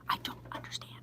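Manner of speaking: hushed, voiceless disbelief; baffled whisper. Real, not acted. Baffled store worker to viewers, whispering. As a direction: whisper it as if at a total loss.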